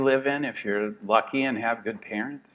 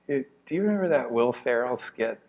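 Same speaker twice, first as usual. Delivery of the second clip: ordinary talk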